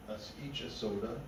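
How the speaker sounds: ordinary delivery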